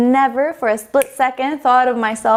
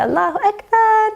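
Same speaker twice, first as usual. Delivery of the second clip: joyful cry; 12 semitones higher than usual. Retold or imitated. Girls crying after shahada.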